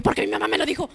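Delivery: laughing mock confession of where it came from; mock blurted punchline. Acted punchline of her retold exchange.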